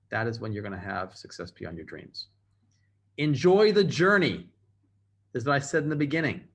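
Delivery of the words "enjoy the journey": loud and higher, slow rallying line; emphatic call. Real, not acted. Speaker urging the audience, loud and slow. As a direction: belt it out to rally the room. Restating key lesson to audience.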